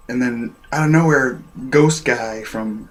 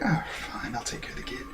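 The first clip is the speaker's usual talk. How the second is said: mock grudging agreement; 5 semitones higher, 11 dB quieter than usual. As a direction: give in reluctantly under your breath. Voices Vegeta's grudging reply.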